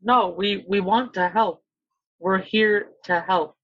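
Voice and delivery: ordinary talk, nothing marked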